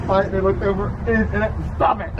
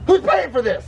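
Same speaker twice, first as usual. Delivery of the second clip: high-pitched indignant demand; indignant dad; 11 semitones higher, 4 dB louder than usual. Acted. Dad demands who is paying, high and indignant. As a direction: demand an answer, pitch raised.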